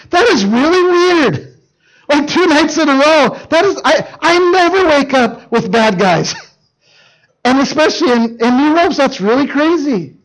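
high, hammed-up dim-witted wonder; mock bafflement. Clueless Samson wonders about waking up tied, mock bafflement. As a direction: puzzle aloud, pitched well up.

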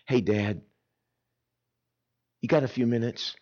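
low, soft, hesitant son; a boy who needs his dad, asking softly; troubled son. Retold or imitated. Son calls his father quietly needing to talk. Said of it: "I hear in that tone".

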